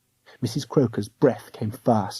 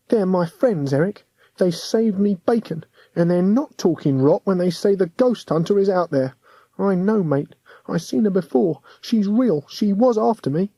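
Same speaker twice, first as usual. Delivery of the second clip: put-on boy's voice; 7 semitones higher than usual. William's lively plea, higher voice.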